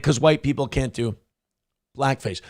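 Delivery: normal talking voice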